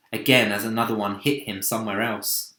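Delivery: ordinary delivery, nothing marked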